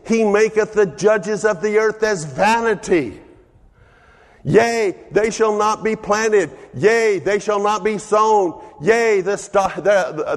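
high, ringing preacher's delivery of scripture; full-force preaching. Acted. Preacher proclaiming scripture to the congregation, full-force preaching.

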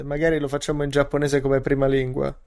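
ordinary talk, nothing marked

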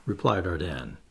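ordinary delivery, nothing marked

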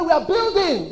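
high-pitched announcing call; high leader's proclamation; rallying cry. Acted. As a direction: cry out the vision to the team. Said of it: "You must hear me cry".

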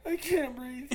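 high whimpering imitation of a sobbing girlfriend; tearful, breathless mock whine; mock crying. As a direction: squeak it out between fake sobs. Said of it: "But she's crying".

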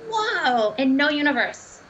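high, loud exclamation of disbelief; amazed. Retold or imitated. Remembering going hours without food, amazed. Retold amazed thought at the time.

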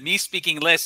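a hint of pidgin English; broken English. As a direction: say it like a confused tourist. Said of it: "I even said that".

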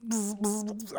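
high buzzing bee sound. Retold or imitated. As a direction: buzz like a swarm of bees. Imitating bees swarming the flowers.

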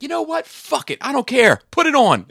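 high-pitched strained impression. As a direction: mimic a fed-up retort way up high. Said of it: "[name] was like".

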